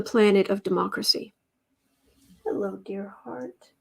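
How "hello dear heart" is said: soft breathy whisper of affection; whisper to pet. Real, not acted. Owner greets her pet in a soft whisper. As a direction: whisper a tender hello to the cat.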